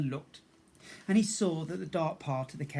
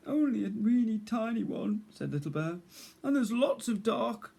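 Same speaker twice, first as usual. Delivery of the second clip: high small timid voice; small scared bear cub; 7 semitones higher, 4 dB louder than usual. Acted. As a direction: speak as a frightened little cub.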